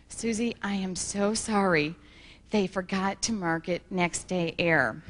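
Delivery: low, slow, soft apology; retold apology. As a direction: act out breaking bad news regretfully.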